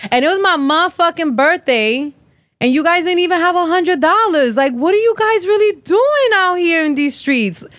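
high, incredulous rising outrage; indignant rant. Real, not acted. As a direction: vent the disbelief, pitch climbing. Ranting about birthday snub.

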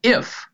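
"If" lifted high and drawn out; stress on first word. Shown as a demonstration. Preacher quoting Jesus, lifting the word "If" high. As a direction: lean hard on "If" before pausing. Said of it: "we skip over the very first word".

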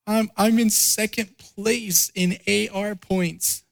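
lightly voiced tearful little girl, high and slow; daughter crying. Preacher imitates crying daughter, a high, tearful little-girl voice. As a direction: imitate his little girl's hurt, tearful voice. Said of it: "she was crying".

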